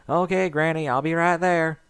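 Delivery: shouting back. Acted. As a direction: call back to upstairs. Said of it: "Applejack shouted back".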